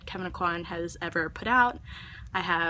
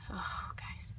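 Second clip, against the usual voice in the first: soft unvoiced groan to viewers; exasperated whisper; 9 dB quieter than usual. Real, not acted. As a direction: plead in a whisper.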